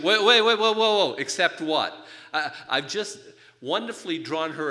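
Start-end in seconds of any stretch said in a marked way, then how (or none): 0.0-1.9 high, loud, startled "wait, wait, wait"; the wife pounces on the word except; alarmed wife. Retold or imitated. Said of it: "And then she goes".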